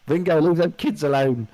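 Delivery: put-on high, loud Liverpool accent; lightly put-on Scouse [name]. Mock Scouse [name] line.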